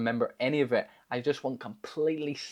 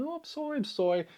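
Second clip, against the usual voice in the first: high, soft, mock-tearful sorry; a retold drunk sob, pitched way up; imitated crying apology; 14 semitones higher, 3 dB quieter than usual. Drunk teen breaking down after fights, mock crying apology. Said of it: "I'd start crying".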